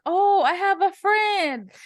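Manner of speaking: put-on high, slow excited kid voice; lightly voiced happy boy; son's delight. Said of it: "and he's like".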